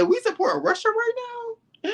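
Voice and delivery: very high, slow disbelieving squeal; a high drawn-out gasp of disbelief; mock incredulous. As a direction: squeak it out in fake shock. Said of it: "I said".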